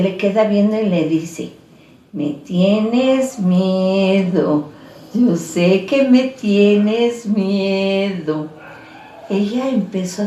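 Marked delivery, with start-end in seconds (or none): 2.1-8.5 voz lenta y arrastrada de niña espectral; niña fantasma. Acted. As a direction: susurra la amenaza muy despacio. Niña fantasma habla a la víctima. (niña fantasma que se burla lentamente)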